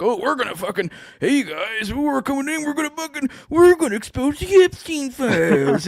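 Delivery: high, hammed-up mock announcement; mock officials' voice. Mocking officials announcing they will expose the files, hammed-up high voice. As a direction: squeak it out like a smug official. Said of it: "you're like".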